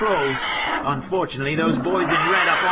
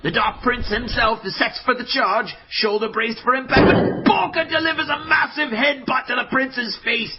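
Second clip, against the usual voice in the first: usual speaking voice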